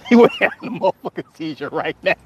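breaking into real laughter mid-line; words dissolving into a laughing fit. Real, not acted. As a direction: crack up as you say it. Cracks up at own mock rebuke.

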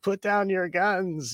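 high, slow, soft mock plea; mock frightened plea. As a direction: beg them in a high pleading voice. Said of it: "that's like".

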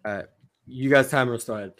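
normal talking voice

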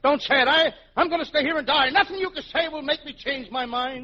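high, loud, hammed-up protest; melodramatic insistence. Man refusing to leave the desert, melodramatic insistence. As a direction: pitch it way up, stubbornly dramatic. Insists he will die here.

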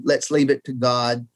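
usual speaking voice

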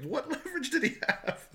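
high-pitched retold disbelief with a laugh; incredulous. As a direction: mimic their baffled amazement. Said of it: "just incredulous".

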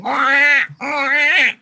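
high squawking seagull wah; seagull cry. Retold or imitated. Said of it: "in a booth going".

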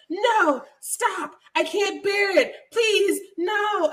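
high, hammed-up pleading cries; imitating her over-the-top no's; mock dramatic protest. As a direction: overplay a breathless protest. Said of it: "she's like".

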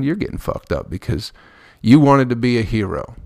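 ordinary talk, nothing marked

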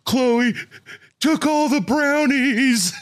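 high, loud, drawn-out mock wail; hammed-up crying child voice; wailing kid. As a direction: tattle like a sobbing little brother. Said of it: "he's screaming and wailing and crying".

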